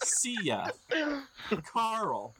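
random words called out high; silly high imitation of bad gibberish; garbled goblin speak. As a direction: toss out nonsense words, high. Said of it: "it sounds like somebody saying".

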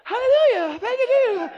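mock the too-blessed saints; overly cheery church folks. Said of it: "I ain't trying to hear".

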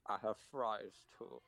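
slow, chewing through words; mouth full. Real, not acted. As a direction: answer mid-bite. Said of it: "I'm eating".

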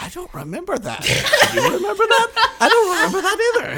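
mock baffled, rising into laughter; confused disbelief. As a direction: act stunned and giggly. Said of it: "being like".

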